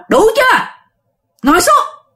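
high, sharp imitated command; retold forceful order from an old master; forceful scolding. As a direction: order them down hard. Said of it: "giọng rất là mạnh bạo".

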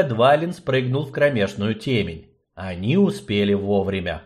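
ordinary delivery, nothing marked

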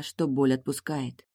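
plain reading voice

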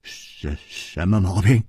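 low stammered start; halting, low-pitched question; hesitant question. A narrator's reading. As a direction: stumble on the first syllable, then ask. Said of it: "几乎又忍不住问了".

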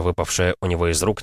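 usual speaking voice

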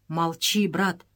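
ordinary delivery

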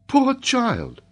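high-pitched lady's voice; pitying lady. A narrator's reading. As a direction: pity him in a high feminine voice. Lady Helena pities the child.

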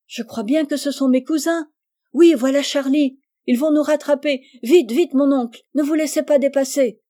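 put-on high, loud girlish excitement; thrilled child in a race; excited urging. A narrator's reading. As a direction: call out eagerly, urging someone to hurry. Rose urges uncle to race cousins.

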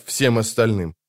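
ordinary delivery

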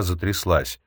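plain reading voice

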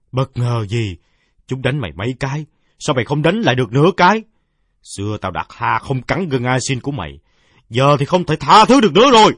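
high, loud, fast furious rat; shrill rat fury. Acted. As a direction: snarl the verdict like a rat. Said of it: "vang lên lanh lảnh". (giọng chuột giận dữ rít lên)

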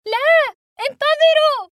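very high, very loud, drawn-out cry; desperate scream. A narrator's reading. Boy screaming at the departing plane, desperate shriek. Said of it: "فعدت أصرخ".

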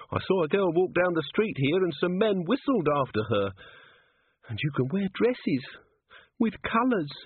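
high-pitched, longing little voice; wistful young dwarf. A narrator's reading. Cheery telling Angua about human girls, wistful.

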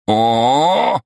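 loud, high mocking howl of oooh; roaring oh. A narrator's reading. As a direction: jeer it out at the put-down. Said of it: "взревела она". (громкое издевательское «о-о-о»)

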